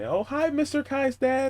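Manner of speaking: put-on high child greeting; kid's voice. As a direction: greet him like a little kid. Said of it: "what most of the kids know me".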